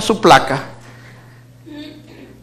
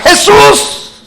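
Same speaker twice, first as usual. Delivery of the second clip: high cry of Jesús; 10 semitones higher than usual. Retold or imitated. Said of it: "lo primero que grita".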